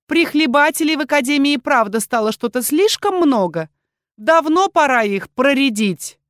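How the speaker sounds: высоко и громко, с вызовом; задирай соперниц высоким голосом; издёвка. A narrator's reading. Оборотка задирает героинь перед академией. (издевательский, вызывающий тон)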